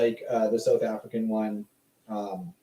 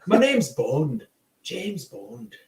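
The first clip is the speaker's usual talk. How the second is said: put-on British accent slipping into Irish; badly faked British spy with an Irish lilt; attempted British accent; 4 semitones higher, much slower than usual. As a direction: try British, land on Irish. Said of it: "That's Irish".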